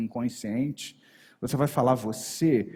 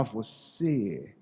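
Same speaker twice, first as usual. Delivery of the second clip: "Você" said lower and much softer; low anchored "você"; 5 semitones lower, 7 dB quieter than usual. Shown as a demonstration. Trainer showing the final anchor word to students, low and soft. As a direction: drop "Você" low and quiet, as if to the inner mind. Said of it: "no final eu só preciso falar".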